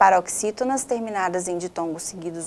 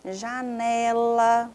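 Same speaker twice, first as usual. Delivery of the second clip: janela very slow, long NE; drawn-out stressed syllable; 3 semitones higher, much slower than usual. Shown as a demonstration. Teacher checking the answer, janela dragged out on NE. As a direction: stretch the NE in janela.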